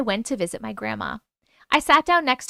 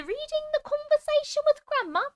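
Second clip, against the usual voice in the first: much higher, sing-song announcement; silly high voice; 14 semitones higher, 3 dB quieter than usual. Acted. Announcing the first story to viewers in a high silly voice. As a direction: chirp the title way up high.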